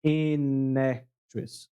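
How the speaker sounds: an actress run together slowly, the N joined onto actress; slowly glue the N of an onto actress; linked an actress. Shown as a demonstration. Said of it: "Percebe que eu junto o N com A". (an actress bem devagar, com o N colado em actress)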